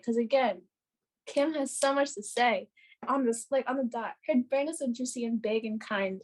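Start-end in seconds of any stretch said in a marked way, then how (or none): none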